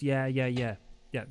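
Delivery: ordinary talk, nothing marked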